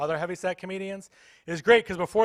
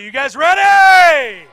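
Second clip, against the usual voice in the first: much higher, louder, slow stretched shout; hype shout; 10 semitones higher, 17 dB louder, much slower than usual. Shown as a demonstration. Comic hyping the audience for participation, stretched hype shout. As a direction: pump up the crowd. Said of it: "I need some energy".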